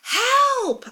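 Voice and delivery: high scream for help; piercingly high call for rescue; loud scream. A narrator's reading. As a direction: scream it loudly, voice way up. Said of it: "I scream loudly".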